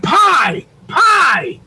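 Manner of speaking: high swooping retold call. Retold calling out to his daughter.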